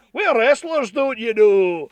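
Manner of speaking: mock wrestler voice for the toys. Voicing the thumb wrestlers on his fingers, playful put-on voice.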